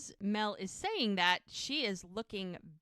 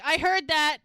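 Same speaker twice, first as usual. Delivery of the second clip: raised, higher-pitched retort; mock indignant shout; 8 semitones higher, 10 dB louder than usual. Character on stage calls out to those sneaking off, mock indignant shout. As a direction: call it out, loud and high.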